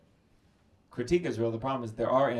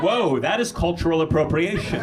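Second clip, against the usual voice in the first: mock outrage; 5 semitones higher, 8 dB louder than usual. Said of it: "I'm like".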